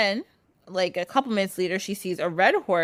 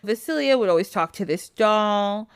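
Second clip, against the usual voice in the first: usual speaking voice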